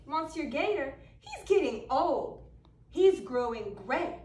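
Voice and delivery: lively storytelling. A narrator's reading. Reading a picture book to kids, lively storytelling. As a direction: spin the tale to a room of children.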